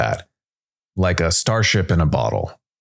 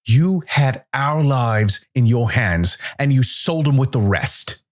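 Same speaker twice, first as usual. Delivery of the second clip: higher, slow, seething anger; rage; 8 semitones higher than usual. A narrator's reading. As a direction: let the old rage pour out slowly. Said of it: "Calder's rage had clearly never cooled".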